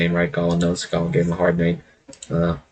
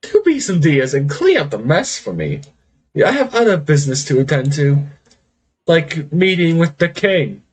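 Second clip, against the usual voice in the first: a bit higher, quick airy command; lightly voiced haughty lich; 6 semitones higher than usual. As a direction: toss it off to the servants. Lich addressing maids.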